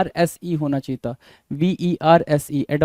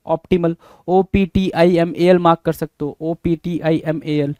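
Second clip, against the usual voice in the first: usual speaking voice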